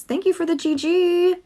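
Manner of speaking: high, sweet thank-you for the viewer; chirpy shop-clerk thanks; customer service voice. Pretend. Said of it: "That's my customer service voice".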